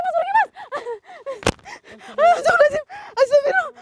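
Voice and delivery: very high, shrill panic; terrified screaming. Acted. Ghost hunter screams to his friend as the creature tries to bite him, very high terrified cries. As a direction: scream for help as it goes for your hand.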